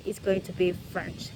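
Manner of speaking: ordinary delivery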